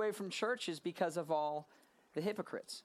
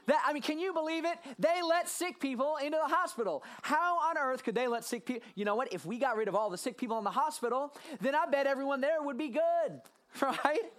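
higher, mock-outraged sarcasm; hammed-up sarcastic disbelief; 5 semitones higher than usual. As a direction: pretend to be scandalized. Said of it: "in my most sarcastic tone".

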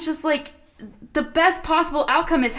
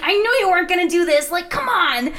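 whiny Jonah; 6 semitones higher, 3 dB louder than usual. Retold or imitated. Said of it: "Jonah's like".

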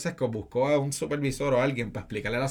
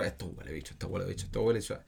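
low grumbling mutter; 6 semitones lower, 9 dB quieter, faster than usual. Retold or imitated. Workers muttering, retold.

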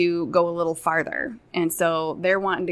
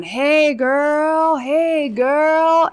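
put-on loud high catcall, hey girl; drawn-out creepy catcall; catcalling yell; 8 semitones higher, 6 dB louder, much slower than usual. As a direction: call out across the street at passing girls. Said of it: "he just keeps going on and on".

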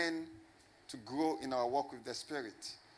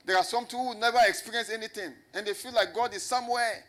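raised, ringing pulpit voice; preaching at full force; 5 semitones higher, 6 dB louder than usual. Acted. Pastor preaching grace to the congregation, full-voiced. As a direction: preach it out higher and louder, driving each point.

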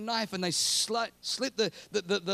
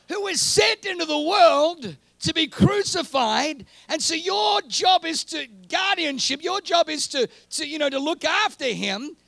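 full-force preaching; 8 semitones higher, 9 dB louder than usual. Acted. Preacher crying out to the congregation about Joseph and Mary, loud. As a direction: thunder it out to the congregation.

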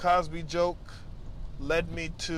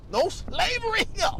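mock exclamation; 3 dB louder than usual. Joking about being a free man.

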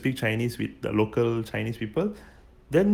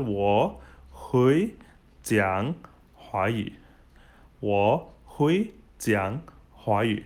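slow, word by word, with gaps; slow model phrase; slower than usual. Shown as a demonstration. Teacher models a Mandarin sentence for beginners, slow and separated. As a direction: space out each word for learners to copy.

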